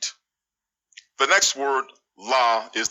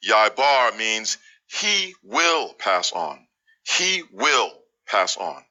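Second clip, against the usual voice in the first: ordinary talk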